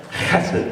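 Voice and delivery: Hesed with a rasping throat-clearing h; Hesed, the h hacked up from the throat; throaty hesed. Shown as a demonstration. Pastor teaching the congregation a Hebrew word, Hesed with a throaty hacking h. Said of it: "pronounced like you're hacking something up".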